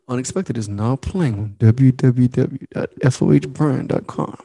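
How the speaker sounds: steady, deliberate sponsor read. Acted. Host reading the podcast sponsor, announcer style.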